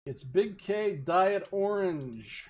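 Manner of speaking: slow dramatic announcement; slow reveal. Acted. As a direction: reveal it with suspense. Drink reveal.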